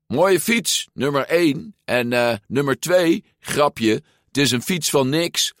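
high, sing-song teasing; mocking taunt. A narrator's reading. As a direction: tease the rider with fake praise. Giulia taunts Luca to test him.

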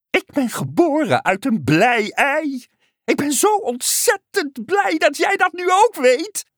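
very high, elated frog voice; ever-happy frog. A narrator's reading. Frog telling the unicorn of its joy, elated. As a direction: gush as an overjoyed frog.

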